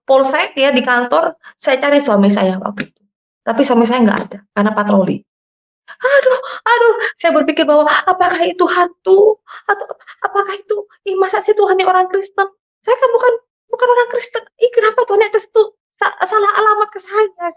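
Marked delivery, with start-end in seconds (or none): 5.9-17.6 high-pitched, flustered self-questioning; retold panicked bewilderment. Recounting her confusion over the vision to listeners, flustered and high. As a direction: act out being rattled and disbelieving, voice pitched up.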